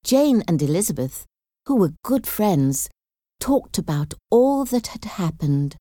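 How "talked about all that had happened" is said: usual speaking voice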